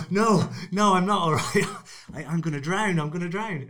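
loud, high, laughing mock panic; hammed-up drowning man, half laughing. Drowning dad answers the old ladies in loud mock panic. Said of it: "And I was like".

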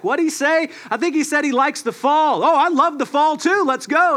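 high-pitched eager bystanders; hammed-up crowd chatter; mock confused crowd. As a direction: pass on the rumor, cluelessly eager. Confused crowd members mishearing each other.

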